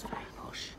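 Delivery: a hushed, impressed-sounding very posh; mock posh whisper. Mock-impressed aside about publishers.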